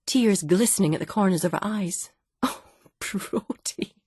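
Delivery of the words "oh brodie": breathless laughing gasp; wife gasping, weak with laughter at him. Acted. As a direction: gasp the name out through helpless laughter. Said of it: "she gasped".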